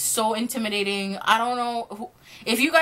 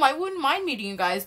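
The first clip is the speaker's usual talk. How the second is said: ordinary talk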